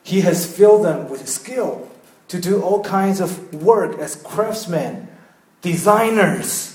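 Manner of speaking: slow, deliberate English reading; slow, reverent verse reading; slow scripture reading. Retold or imitated. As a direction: read each word slowly, savouring it.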